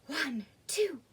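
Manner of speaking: usual speaking voice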